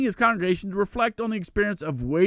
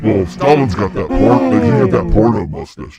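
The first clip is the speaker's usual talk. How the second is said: deep booming demon, lower and louder; a big demon proudly touring hell; 3 semitones lower, 12 dB louder than usual. Pretend. Same demon voice continues.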